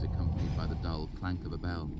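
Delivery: usual speaking voice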